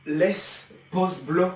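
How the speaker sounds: slow hammed-up parody of the sign-off; mocking parody. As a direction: stretch each word to ridicule it. Mocking the clip's phrase.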